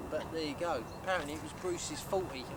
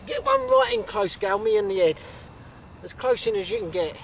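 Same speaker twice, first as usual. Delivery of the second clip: calling out; 3 semitones higher, 11 dB louder than usual. Real, not acted. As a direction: shout the instructions over to the photographer. Calling instructions to distant photographer.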